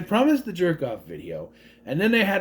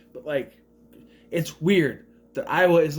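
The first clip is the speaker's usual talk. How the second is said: normal talking voice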